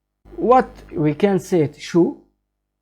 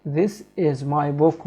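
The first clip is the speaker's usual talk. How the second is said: slow example; 2 semitones lower, 4 dB quieter, slower than usual. Shown as a demonstration. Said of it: "So the full meaning of it".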